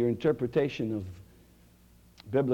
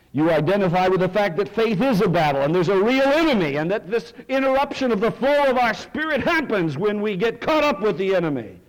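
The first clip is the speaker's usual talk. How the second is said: higher, louder, driving sermon voice; full-force preaching; 9 semitones higher, 8 dB louder than usual. Acted. Pastor preaching to the congregation, full force.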